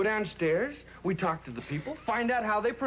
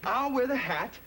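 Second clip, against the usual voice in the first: ordinary delivery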